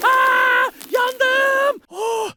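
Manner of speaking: high, loud yelps of burning pain; mouth on fire. Acted. Villain shouting as the sauce burns his mouth. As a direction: yelp as the hot sauce burns your tongue.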